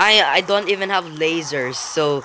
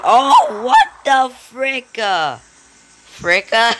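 loud, high cry falling into slow disbelief; real gamer shock, pitched up; shocked outburst; 6 semitones higher, 5 dB louder, slower than usual. Real, not acted. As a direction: start high and loud, then drag out the words. Shocked by game event.

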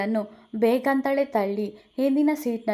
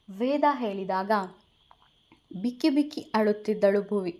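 ordinary delivery, nothing marked

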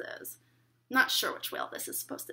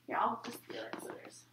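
whispering; 2 semitones lower, 10 dB quieter than usual. Real, not acted. Aside to guest during demo.

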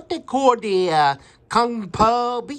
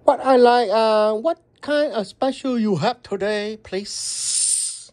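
customer's put-on Chinese accent. Customer phoning restaurant to order, in a Chinese accent. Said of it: "we're gonna do a Chinese accent".

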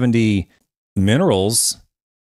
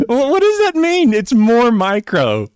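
incredulous laughing; 10 semitones higher than usual. Real, not acted. Mocking the clip's claim.

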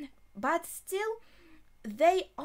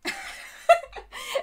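high, loud laugh; amused laughter; 5 dB louder than usual. Real, not acted. Viewer watching what Joseph is doing, laughing high. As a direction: crack up for real.

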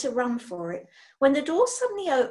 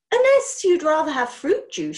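loud, high eager hostess; eager eccentric witch; 5 semitones higher, 7 dB louder than usual. Acted. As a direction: press the offer brightly. Witch figure offers juice.

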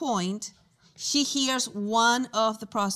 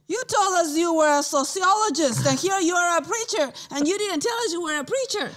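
higher, louder, fast retelling of the man; amazed shopkeeper; 8 semitones higher, 7 dB louder, faster than usual. Retold or imitated. Shop owner to Tony right after the prayer, amazed and loud. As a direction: blurt it out in disbelief.

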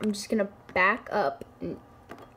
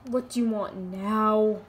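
normal talking voice

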